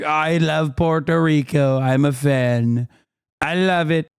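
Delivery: slow, drawn-out mock politician voice; Trump. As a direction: stretch it out as a mocking impression. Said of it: "Trump is like".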